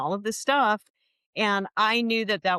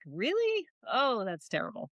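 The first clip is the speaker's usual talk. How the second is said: surprised; 3 semitones higher, 5 dB quieter than usual. Retold or imitated. Retold reaction to a customer problem.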